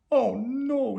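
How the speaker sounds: high, loud alarmed cry. A narrator's reading. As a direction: gasp as he falls. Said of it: "he cried".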